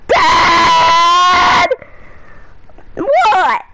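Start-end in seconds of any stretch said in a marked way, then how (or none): 0.1-1.7 mock yell. Son yelling at dad.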